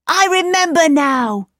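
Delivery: put-on high, excited boy's voice; delighted realisation. A narrator's reading. Kay tells Gerda he remembers, delighted. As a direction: burst out as memory comes back. Boy remembers his home.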